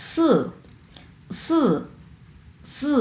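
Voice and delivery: "সু" with a short, falling fourth tone. Shown as a demonstration. Teacher modelling Chinese four for students, fourth tone. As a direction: drop sharply on "সু". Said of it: "এটা হচ্ছে চার number tone".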